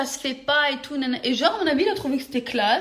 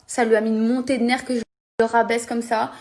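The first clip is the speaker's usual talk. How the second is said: ordinary delivery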